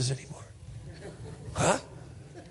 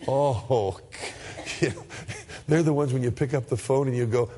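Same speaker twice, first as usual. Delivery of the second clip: normal talking voice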